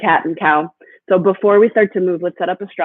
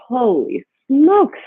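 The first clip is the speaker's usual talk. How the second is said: laughing exclamation; 8 semitones higher, slower than usual. Real, not acted. Exclaiming at the hard hold.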